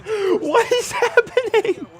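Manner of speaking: high, slow, loud wail breaking into laugh-cry; anguished wail. Real, not acted. Gamer wailing at another dropped pass, high laughing cry. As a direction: wail it like you can't take it anymore. Ball dropped in receiver's hands again.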